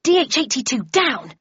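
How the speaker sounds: sharp command yelled across the kitchen; yelling at the pet. Acted. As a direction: bark the order at the thylacine. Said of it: "she yelled".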